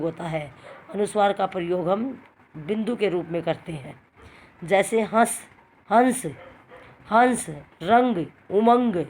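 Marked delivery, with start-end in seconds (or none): 4.6-9.0 हंस, रंग, उमंग with the nasal ng drawn out slowly; nasal anusvar demo. Shown as a demonstration. Teacher shows the class how the anusvar sounds. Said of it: "खाली नाक में से आवाज़ आई ना".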